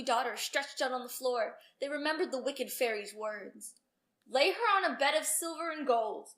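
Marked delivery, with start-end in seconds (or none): 4.3-6.3 loud, firm royal order; king commanding his servants. Acted. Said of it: "the king commanded".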